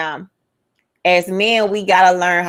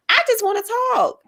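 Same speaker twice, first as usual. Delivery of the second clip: acted protest pitched way up; high-pitched mock plea; 14 semitones higher than usual. Woman acting out asking her man to talk, high mock plea. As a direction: plead in an exaggerated high voice.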